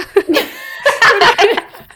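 big high laugh bursting out; loud burst of laughter. Real, not acted. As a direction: laugh out loud at your own joke. Laughing at her own joke. (carcajada fuerte y aguda)

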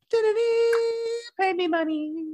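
high, drawn-out sing-song mimicry; imitating Gilda. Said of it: "Gilda's like".

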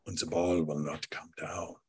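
a hushed, eerie whisper of "ball". Retold or imitated. Retelling Herzog's story to the hosts, spooky whisper.

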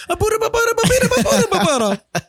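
very high, fast, loud babbling mock Italian; squeaky high-speed Mario gibberish, hammed up; Mario and Luigi gibberish. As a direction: rattle off nonsense syllables like a cartoon plumber. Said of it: "That's literally what they sound like".

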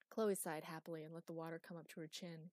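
ordinary delivery

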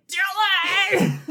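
high, loud drawn-out scream of a name; hammed-up shriek of recognition; mock shout. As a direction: yell it out imitating her shock. Acting her cry of his name.

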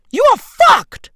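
loud, high-pitched verdict; emphatic outburst. Real, not acted. Telling the hopeless man his fate, emphatic outburst.

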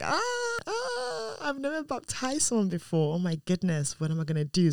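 high-pitched comic wail, easing as it goes; mock panic. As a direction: re-enact panicking with a long squeal first. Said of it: "I was panicking".

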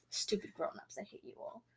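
very soft, voiceless aside; hushed mock-resentful whisper; whispered grumble. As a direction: grumble under your breath at grown-ups. Muttered aside about adults.

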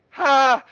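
high, loud teasing ha; a mock gotcha laugh-shout; gleeful ha. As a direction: bark a triumphant ha. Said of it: "she's like".

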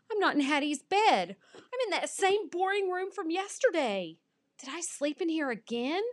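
high, put-out whine; high-pitched dismay; dismayed complaint. A narrator's reading. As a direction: protest like a disappointed pup. Dog dismayed at waking place.

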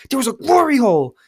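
high, loud blurted confession; retold agitated shout; flustered. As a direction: burst out with it, pitch soaring. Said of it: "now I'm all flustered".

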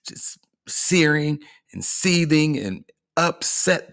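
slow, hissed whisper of resentment; slow voiceless seething. Acted. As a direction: whisper it slowly like someone stewing in a corner. Describing a seething enemy.

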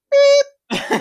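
very high, loud bleep sound; mock censor beep. Censoring the song title for the podcast, mock beep.

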